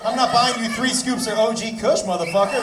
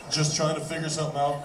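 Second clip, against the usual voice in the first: a hint of a stoned guy, lower and softer; lightly voiced stoner; 6 semitones lower, 5 dB quieter than usual. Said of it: "when you're that high".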